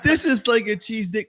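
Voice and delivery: ordinary talk, nothing marked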